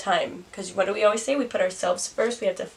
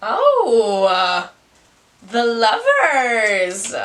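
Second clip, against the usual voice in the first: loud startled gasp then slow, loud card name; a loud real burst of surprise; surprised outcry; 8 dB louder, much slower than usual. Real, not acted. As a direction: blurt it out, caught off guard. Card turns up mid-reading.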